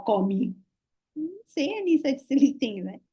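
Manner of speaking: ordinary talk, nothing marked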